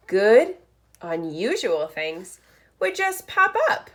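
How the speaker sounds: lively picture-book read-aloud; reading to children. Acted. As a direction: read it like story time for first graders. Reads picture book aloud to class.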